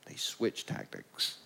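slow unvoiced whisper. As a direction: drop to a secret whisper. Punchline whispered.

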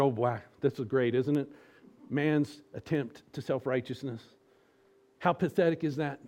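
ordinary talk, nothing marked

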